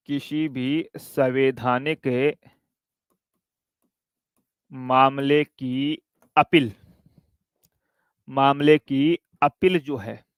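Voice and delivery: teacher reading out notes to copy down; slow dictation. Shown as a demonstration. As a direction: dictate it slowly so students can write. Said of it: "लिख लो".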